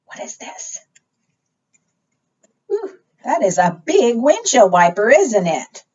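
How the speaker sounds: ordinary talk, nothing marked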